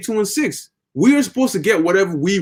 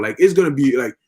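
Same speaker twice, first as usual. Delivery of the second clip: normal talking voice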